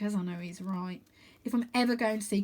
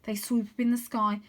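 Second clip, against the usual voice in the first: usual speaking voice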